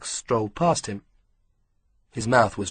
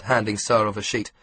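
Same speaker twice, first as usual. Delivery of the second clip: usual speaking voice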